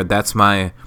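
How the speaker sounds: ordinary talk, nothing marked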